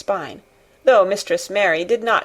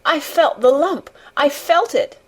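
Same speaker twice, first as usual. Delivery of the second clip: put-on high, anguished boy's cry; choked, high-pitched wail; 8 semitones higher than usual. A narrator's reading. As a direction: insist through acted tears. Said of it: "choked out Colin".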